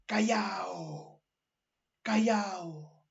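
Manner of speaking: long drawn-out cry, kept soft; mock crowd shout. Teacher imitates townspeople as ship is hit, hushed mock yell. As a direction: imitate a far crowd cheering, held back. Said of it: "La gente gritaba".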